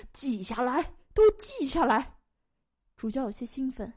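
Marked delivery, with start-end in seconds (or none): 0.1-2.2 worked-up bishop demanding a record; excited order. Acted. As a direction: urge the scribe on, louder on the repeat.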